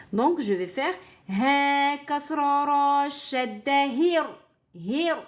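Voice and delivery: slow chanted Quranic syllables; tajwid recitation. Shown as a demonstration. Teacher demonstrating the syllables slowly. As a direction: spell out each harakat slowly. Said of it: "recites the letters slowly".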